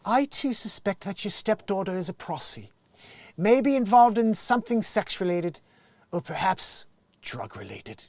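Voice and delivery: high, put-on English columnist voice; English accent. Dear Abby answers a suspicious stepmother, mock English accent. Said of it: "is Dear Abby an English person?".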